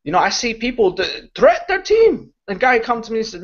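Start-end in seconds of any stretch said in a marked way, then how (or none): none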